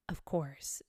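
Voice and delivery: low, grave reply. A narrator's reading. Dodo replying to the Mouse, very gravely. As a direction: answer solemnly, pitched low. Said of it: "the dodo replied very gravely".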